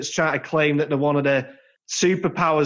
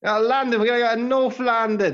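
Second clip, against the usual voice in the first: slightly higher, botched Cockney; bad Cockney accent; 6 semitones higher than usual. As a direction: attempt a London Cockney and miss. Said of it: "done a better Cockney accent".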